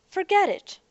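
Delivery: bright sudden exclamation; excited. Acted. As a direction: shake it off with a burst. Brushing off apology, sudden cheer.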